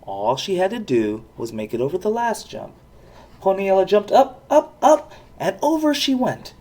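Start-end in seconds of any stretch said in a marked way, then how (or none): none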